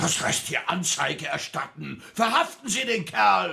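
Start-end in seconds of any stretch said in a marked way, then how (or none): 2.1-3.5 laut fordernd. Acted. Streit mit dem Polizisten.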